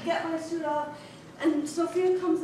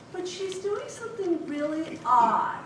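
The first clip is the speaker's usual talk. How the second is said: ordinary talk, nothing marked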